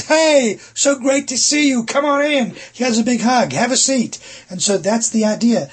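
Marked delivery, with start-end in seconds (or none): 0.0-4.2 much higher and louder, bursting with delight; overjoyed welcome. Shown as a demonstration. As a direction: greet a dear friend you're thrilled to see.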